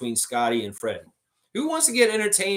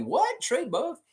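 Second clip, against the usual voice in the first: mock disbelief; slower than usual. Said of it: "I'm like".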